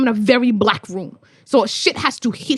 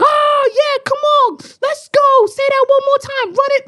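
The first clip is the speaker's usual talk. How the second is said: hyped crowd calls; 13 semitones higher than usual. Retold or imitated. London crowd cheering a poet, hyped calls. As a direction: call out like a cheering audience. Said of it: "it's like".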